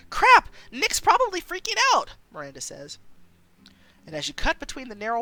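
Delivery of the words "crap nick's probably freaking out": much higher and louder, panicked; alarmed outburst. Acted. Miranda realising Nick is waiting, loud panicked outburst. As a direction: blurt it out in a sudden panic.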